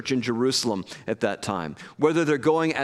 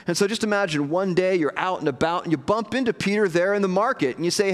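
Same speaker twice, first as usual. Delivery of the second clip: usual speaking voice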